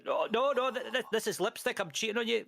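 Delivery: high, panicky mock denial, pitched well up; flustered excuse. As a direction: squeak out a guilty, flustered excuse. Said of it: "And I was like".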